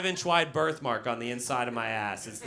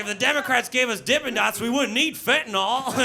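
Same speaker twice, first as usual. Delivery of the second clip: small-town locals; 8 semitones higher, 5 dB louder than usual. Retold or imitated. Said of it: "They're like".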